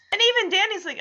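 ordinary talk, nothing marked

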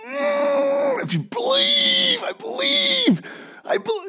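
high, straining cry of a whipped-up worship leader; mock worship leader. Preacher mocking a worship leader whooping up the crowd, high frantic cry. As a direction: ham up a frantic worship leader belting belief.

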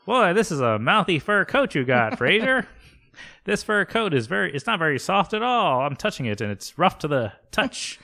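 high, theatrical disdainful voice; Glazer. Acted. Agent mistakes Roz for a coat, high theatrical disdain. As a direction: complain about it in a high, haughty voice. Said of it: "Glazer just like".

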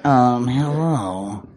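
slow, low game-character voice; put-on deep, slow greeting. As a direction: draw it out down low. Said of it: "stupid fucking voice".

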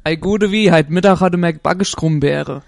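broad Hessian dialect greeting, loud; regional dialect. Shown as a demonstration. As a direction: lay on the local Hessian dialect. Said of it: "What would be a regional thing?".

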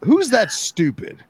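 exasperated. Real, not acted. Exasperated rhetorical question.

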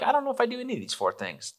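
high, quick, clueless whine; mock innocent. Spouse on the drive home, faking innocence in a high squeak. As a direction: play dumb in a squeaky voice. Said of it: "if you're feeling like".

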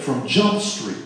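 put-on "From Jump Street" tossed off playfully in kids' slang. Preacher borrowing his kids' slang for the congregation. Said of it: "how do my kids say it".

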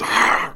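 unvoiced imitated dog snarl; imitated growl of a dog snapping; mock dog snarl. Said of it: "the dog goes".

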